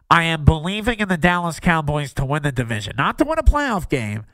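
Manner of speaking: talking while pinching the nose shut; nasal, nose-held voice, like a bad smell; pinched nose impression. Shown as a demonstration. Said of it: "I'm holding my nose as I say this".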